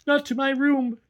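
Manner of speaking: put-on high, urgent begging boy; pleading boy. A narrator's reading. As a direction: beg not to be locked away. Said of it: "he pleaded".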